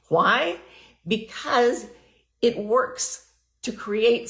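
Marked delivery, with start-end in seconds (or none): none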